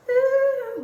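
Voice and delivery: high, loud squeal of delight; a thrilled gasp at her own look; excited ooh. Real, not acted. Showing off her scarf to viewers, delighted high ooh.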